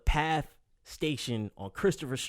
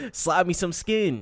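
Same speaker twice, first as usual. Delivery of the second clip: mock dated slang; 6 semitones higher, 5 dB louder, much slower than usual. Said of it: "That's like saying".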